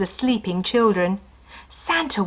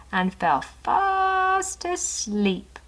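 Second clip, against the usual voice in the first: very slow, higher, softer; sleepy trailing; 10 semitones higher, 3 dB quieter, much slower than usual. A narrator's reading. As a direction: drift off with him. Story drifts to sleep.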